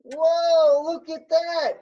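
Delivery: high, loud, delighted whoa; wowed and cheering a student on; real amazement. Real, not acted. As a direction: exclaim in amazement at the launch. Student's rocket launches successfully.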